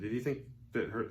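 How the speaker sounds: normal talking voice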